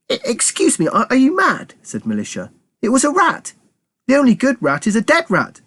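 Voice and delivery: high, shocked woman's voice; indignant Militia. Acted. Militia scolding Keith, high and indignant. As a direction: snap at the boy in disbelief.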